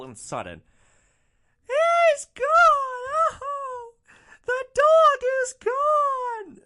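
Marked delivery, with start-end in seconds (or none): 1.7-6.6 drawn-out, very high hysterical wail; hammed-up bawling at full volume; mock shrieking wail. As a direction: imitate a passenger wailing in grief. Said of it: "like, ear-piercing shrieks".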